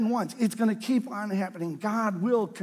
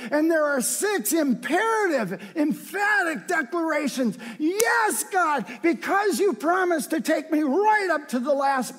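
preaching at full force; 6 semitones higher than usual. Acted. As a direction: shout the psalmist's vows of praise. Voices the psalmist's vows of praise.

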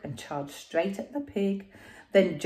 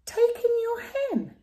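high, far-off sage voice; a sly old sage's advice; wise old sage; 4 dB louder than usual. Acted.

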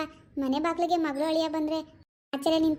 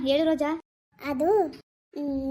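ordinary delivery

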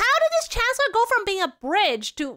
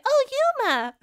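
put-on anime girl call; 7 semitones higher than usual. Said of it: "I'm like".

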